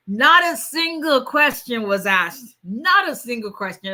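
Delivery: building to an excited shout; amazed emphasis. Real, not acted. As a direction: marvel at it out loud. Amazed client approved easily.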